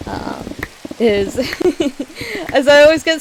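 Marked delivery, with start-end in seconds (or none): none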